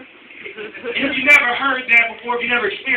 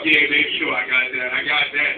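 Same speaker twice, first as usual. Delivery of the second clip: lower, cool confident husband; smooth bravado; 6 semitones lower than usual. Retold or imitated. Husband bragging to his wife, lower cool swagger. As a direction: lay it on smooth.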